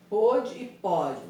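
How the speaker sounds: "Pôde e pode" lento e forte, ô fechado e ó aberto; contraste pôde e pode. Shown as a demonstration. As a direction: separe bem "Pôde" e "pode", bem devagar. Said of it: "o acento diferencial". (contraste lento e enfático entre "Pôde" e "pode")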